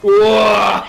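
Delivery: loud, high startled cry; startled whoa. Real, not acted. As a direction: shout in surprise.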